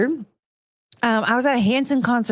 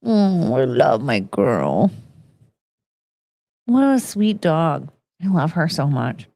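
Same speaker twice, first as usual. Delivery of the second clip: cooing to dog; 6 semitones lower than usual. Real, not acted.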